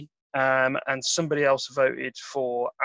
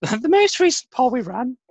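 much higher, louder silly voice; high-pitched voice; 11 semitones higher, 5 dB louder than usual. Pretend. Host introducing the poll results, high-pitched joke voice. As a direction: sing it out way up high. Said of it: "that high-pitched voice".

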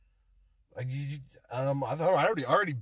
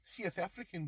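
put-on soft, higher South African accent; 5 semitones higher, 10 dB quieter than usual. Co-host suggests an impression, South African accent. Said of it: "thought you were gonna do".

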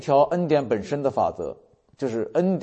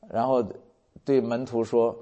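ordinary talk, nothing marked

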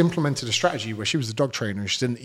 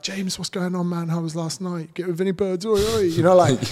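high, rowdy lad voice, climbing to a loud "Oi, oi"; matey fan greeting; 7 semitones higher, 3 dB louder than usual. Retold or imitated. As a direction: play an excited fan who's your mate. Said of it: "They're like".

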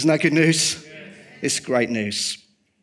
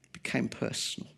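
whispered repeat; 10 dB quieter than usual. Shown as a demonstration. Preacher echoing his point to the church in a whisper. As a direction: whisper the words, letting them linger.

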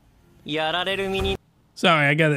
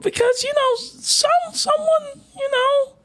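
very high, whimpering voice; mock whiny girl. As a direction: squeak it out like she's about to cry. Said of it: "She's like".

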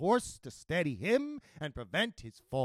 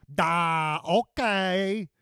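loud, oafish simpleton; village idiot; 3 semitones higher, 5 dB louder, much slower than usual. A narrator's reading. Village idiot agreeing to the lady's scheme, oafish voice. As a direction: answer loudly like a slow-witted oaf.

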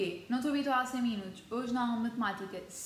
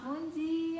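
cheery greeting; 7 semitones higher than usual. Retold or imitated. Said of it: "Eu chego lá".

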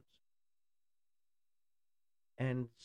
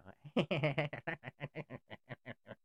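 a put-on giggle, mocking; mock nervous laugh; 4 semitones higher than usual. Mocking the vice president with a nervous laugh. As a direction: fake a silly nervous laugh to ridicule her. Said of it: "she starts laughing".

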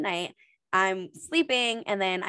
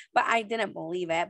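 ordinary talk

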